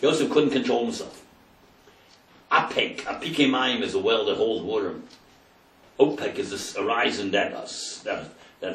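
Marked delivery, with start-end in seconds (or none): none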